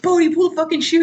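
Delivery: high, fast retold yell; shouting. Johnny begging Bodhi mid-fall, high fast yell. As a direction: yell it over the wind.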